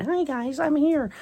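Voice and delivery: put-on very high squeaky little kid. Kid joining an Xbox voice chat, very high squeak. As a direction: squeak it like a young child on voice chat. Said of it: "If I came into a voice chat, went".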